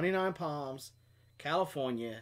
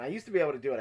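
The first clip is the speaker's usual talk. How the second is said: ordinary delivery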